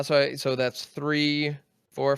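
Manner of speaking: normal talking voice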